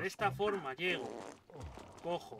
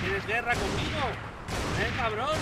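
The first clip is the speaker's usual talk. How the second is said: louder, provoking shout; taunting challenge; 5 semitones higher, 9 dB louder than usual. Real, not acted. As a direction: square up to the enemy. Taunting an enemy he fights.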